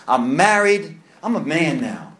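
ordinary talk

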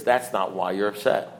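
low, soft, understated sponsor voice; imitating a sponsor's quiet certainty; sponsor's verdict. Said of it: "He says".

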